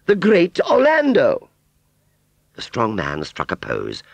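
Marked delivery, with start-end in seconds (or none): 0.1-1.5 very high, loud, drawn-out title; grand proclamation. Acted. Aunt Sally announcing the strong man's title, grand. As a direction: proclaim the name slowly and proudly.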